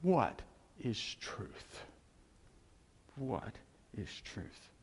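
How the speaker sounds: slow, soft, fading into a whisper; musing Pilate. Retold or imitated. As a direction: let the question dissolve to a breath. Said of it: "Pilate said to Jesus".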